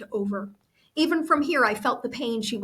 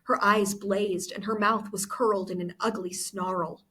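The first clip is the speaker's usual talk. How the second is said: ordinary delivery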